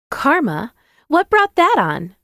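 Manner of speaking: high-pitched, surprised questioning voice; curious female friend. A narrator's reading.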